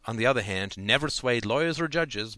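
ordinary delivery

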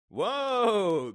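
loud, high, drawn-out yell; startled cry. A narrator's reading. Father jumping up at his son's outburst, crying out. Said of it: "cried Dave".

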